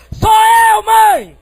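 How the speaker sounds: very high, loud call from afar; full-voiced yell. Returning son calling to his mother from the gate, high yell. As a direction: yell it with all the lungs.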